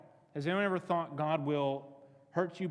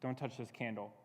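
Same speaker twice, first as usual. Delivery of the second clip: low, soft, tender caution; loving warning; 5 semitones lower, 8 dB quieter than usual. Acted. God standing lovingly beside you, tenderly warning. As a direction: warn gently and lovingly, voice dropped. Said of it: "standing here lovingly with you saying".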